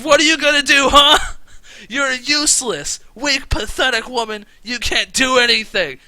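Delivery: berating at a high-pitched full shout; Kafu. Acted. Kidnapper berating his tied-up captive, furious shout. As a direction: scream abuse like a cruel villain. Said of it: "starts berating her".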